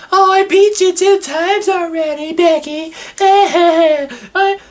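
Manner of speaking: mock her as a smug complainer; high smug whining impression; whiny Charlotte. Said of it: "bitching and complaining, like".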